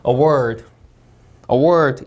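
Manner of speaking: "award" with WARD stressed; a-WARD, "award" ending in a fall; second-syllable stress. Shown as a demonstration. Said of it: "이음절 강세".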